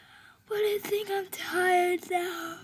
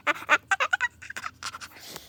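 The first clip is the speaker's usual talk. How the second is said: shrill, squealing hyena-like laugh; high laugh; 10 semitones higher than usual. Acted. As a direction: let out a high giggling cackle. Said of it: "Do I laugh like a hyena?".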